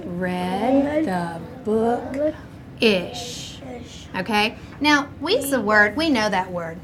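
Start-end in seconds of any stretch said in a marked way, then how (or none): 0.1-3.6 drawn-out choral reading; slow read-along. Shown as a demonstration. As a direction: stretch each word for the class. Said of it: "Read along with me".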